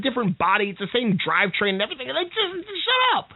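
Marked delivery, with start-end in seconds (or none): 2.1-3.3 high, fed-up retort; exasperated. Real, not acted. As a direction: cut them off, fed up.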